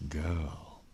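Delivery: ordinary delivery, nothing marked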